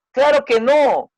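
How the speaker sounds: father firmly contradicting his son. Acted. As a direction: deny it firmly, drawn out. Father answers, acted dialogue.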